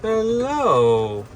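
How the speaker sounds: loud high hello. Acted. Loud high greeting to the parrot.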